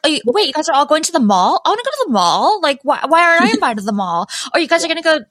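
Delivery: playful, put-on pouty protest. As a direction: pout it like someone left off the invite. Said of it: "all pouty".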